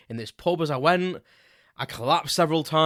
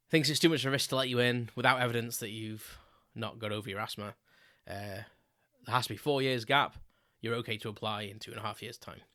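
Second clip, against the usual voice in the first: disappointed reading; 3 semitones lower, 7 dB quieter than usual. Retold or imitated. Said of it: "you can hear the disappointment in my voice".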